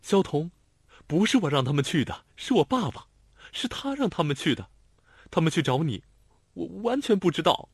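put-on a flustered young woman pleading her innocence. A narrator's reading. Girlfriend explains to Xiao Tong, flustered and pleading. Narrator voices Lanlan.

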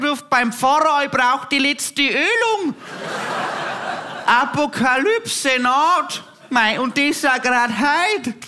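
put-on high, slow, wailing old granny; hammed-up granny lamenting the end of the world; grandma shocked by a vegan guest, wailing to the priest; horrified grandma. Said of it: "was meine Oma gesagt hat".

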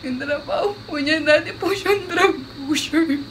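high words squeezed through laughter; cracking up. Real, not acted. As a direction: lose it while reciting a sad plea. Cracks up over own sad story.

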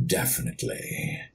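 low, slow, menacing villain voice; drawn out and savored like a smug mastermind; low villain voice, Wesker-tastic whispered slowly at the end. Shown as a demonstration. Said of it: "wants to know if you can say something for her".

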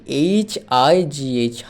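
each letter spelled out very slowly; slow letter spelling. Shown as a demonstration. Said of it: "হাই বানান কি?".

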